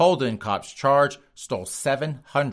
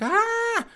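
mock shriek. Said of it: "she's like".

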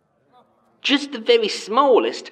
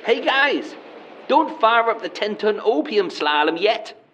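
ordinary talk, nothing marked

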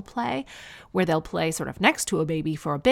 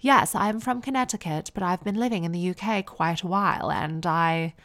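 posh American, British twang. Retold or imitated. Imitating a mom from music class, posh American-British twang. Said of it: "posh American with that British twang".

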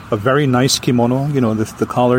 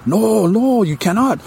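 higher, urgent holding back; pleading wife; 9 semitones higher, slower than usual. Retold or imitated. Wife stopping her husband at the stairs, high and urgent. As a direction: stop him, pitched up. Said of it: "And the woman says".

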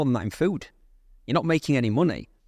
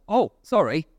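higher-pitched retold backing down; sudden apology; 5 semitones higher, 3 dB louder, slower than usual. Seller realising who is calling, suddenly apologetic. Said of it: "I've gone from being quite".

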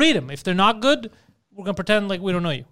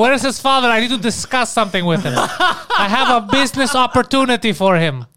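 loud, higher-pitched put-on dad voice; Pantelis' father; 6 semitones higher, 6 dB louder than usual. Host imagines the father writing in to the show, loud dad impression. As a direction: play the father, pitched up and emphatic. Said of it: "where's Pantelis' father?".

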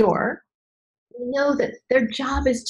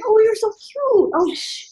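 put-on baby talk to chihuahua; 12 semitones higher than usual. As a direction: coo at a tiny dog. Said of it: "you're like".

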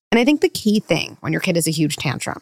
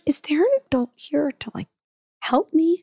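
put-on pleading child voice; 8 semitones higher than usual. Child voiced asking for adult.

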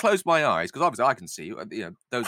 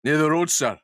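raised, loud, comically rough Scottish; rough Scottish accent; 5 semitones higher, 5 dB louder than usual. Acted. Lizzie tells Jamie where it happened, rough Scottish accent. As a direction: launch into a drifting Scottish-northern accent. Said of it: "I'll go with that then".

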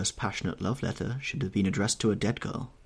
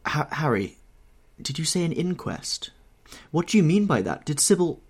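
stammered, higher-pitched dread; stifled stammer; 6 semitones higher than usual. A narrator's reading. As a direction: falter on the name, then press the questions in horror. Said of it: "he stammered in a stifled voice".

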